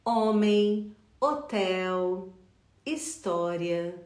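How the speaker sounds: "Homem, hotel, história" begun on bare vowels; "hotel" and "história" starting straight on the vowel; silent H. Shown as a demonstration. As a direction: list the Portuguese words slowly, no H sound at all. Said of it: "nós não pronunciamos o H".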